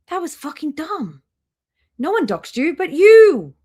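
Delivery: rising, exasperated rant that peaks in a loud high yell on the last words; exasperated outburst. Real, not acted. Scolding the streamer for doxing herself.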